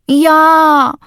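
a loud, high groaning "Ja"; whiny drawn-out yes. A narrator's reading. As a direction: let it out as a theatrical moan. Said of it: "stöhnte Sammy".

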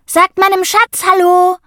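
high and loud; a boy presenting his seal on camera; excited introduction. A narrator's reading. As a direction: show off your pet proudly. Sammy shows his seal.

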